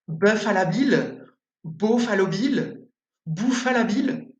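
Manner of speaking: put-on "Buffalo Bill" tried in an English, Australian, then American accent, rising each time; a teacher's clumsy try at an anglophone accent on "Buffalo Bill"; mock English accent. Teacher repeating the name in a put-on English accent for her student. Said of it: "j'ai donc essayé de répéter en imitant l'accent anglais, australien, américain".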